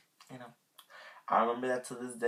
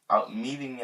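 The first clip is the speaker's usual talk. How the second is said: ordinary talk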